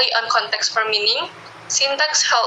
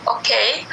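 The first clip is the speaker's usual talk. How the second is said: "Okay" lifting at the end; rising intonation. Shown as a demonstration. Teacher quotes Alina having more to say, rising "Okay". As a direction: raise the second syllable of "Okay", left unfinished. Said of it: "with rising intonation".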